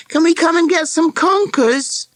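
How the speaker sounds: put-on high, loud girl calling through a door. A narrator's reading. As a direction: call it out high and eager. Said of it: "she called".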